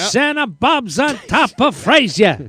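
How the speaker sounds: high, slow boxing commentator; emphatic, drawn-out sportscaster impression; Howard Cosell. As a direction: drag out each phrase like ringside commentary. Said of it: "a little more, uh, Howard Cosell".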